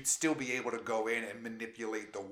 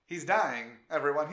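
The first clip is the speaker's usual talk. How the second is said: loud, higher mock announcement; 4 semitones higher, 6 dB louder than usual. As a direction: call it out to the crowd like news.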